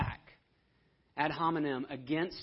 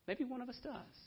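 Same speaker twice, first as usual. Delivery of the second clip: timid; 9 semitones higher, 8 dB quieter than usual. Retold or imitated. Nicodemus speaks up timidly.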